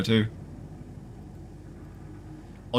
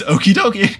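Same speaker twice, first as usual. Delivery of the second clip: chirpy note voice; 9 semitones higher, 7 dB louder than usual. Retold or imitated. Reading note in cheerful voice.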